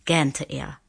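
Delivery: ordinary delivery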